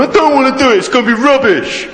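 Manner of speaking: hammed-up sulky child; whiny boy. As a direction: whinge like a stubborn kid. Imitating sulky schoolboy.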